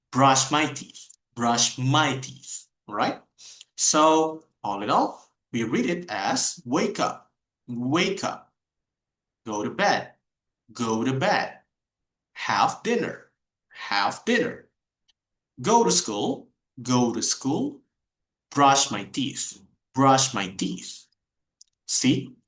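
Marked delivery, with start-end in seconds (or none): 0.1-2.7 "Brush" apart, "my teeth" joined; my teeth linked. Shown as a demonstration. Teacher showing the class how to read brush my teeth. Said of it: "you connect my and teeth". 6.7-21.1 each phrase said twice, linked and fluent; connected speech. Shown as a demonstration. Teacher reviewing all the phrases in connected speech. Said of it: "So that's how you sound fluent".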